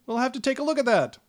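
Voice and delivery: high, quick flustered gnome; startled gnome brushing off the bird, high and quick. Acted. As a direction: jump and bluster it out. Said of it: "the gnome just kinda jumps and says".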